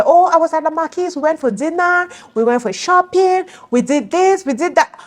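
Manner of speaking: high, sing-song list of outings; put-on chirpy bragging, pitched well up; mock gushing. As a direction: imitate a friend gushing in a high voice. Mimicking friends' cheerful outing reports.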